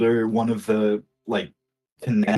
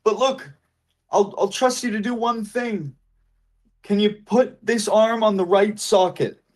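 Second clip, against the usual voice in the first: mannequin voice; 11 semitones higher than usual. Acted.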